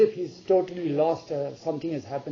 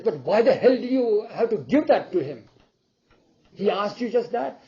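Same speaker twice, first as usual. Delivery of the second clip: raised, higher voice in protest; mild exasperation; 5 semitones higher, 5 dB louder than usual. Acted. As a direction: scold in disbelief, acting out a quarrel. Acted quarrel between queen and king.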